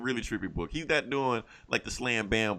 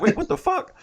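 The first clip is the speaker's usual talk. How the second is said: stunned; 6 dB louder than usual. Retold or imitated. Hero stunned by crowd turning.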